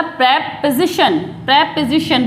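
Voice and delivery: "प्रीपोजिशन" broken as PREP... puh-zi-shan; "प्रीपोजिशन" slowly, with a weak middle vowel; correct pronunciation. Shown as a demonstration. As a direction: lean on "prep", then fall through the rest of "प्रीपोजिशन". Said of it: "क्या बोला जाता है".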